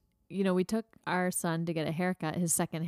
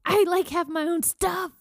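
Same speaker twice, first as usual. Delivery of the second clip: exasperated; 10 semitones higher, 6 dB louder than usual. Real, not acted. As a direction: vent like you're fed up. Exasperated rant about sharing.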